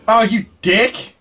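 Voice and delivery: slow loud outburst of shock; startled shout. Real, not acted. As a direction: yell it out, high and loud, caught off guard. Jumped by a monster in game.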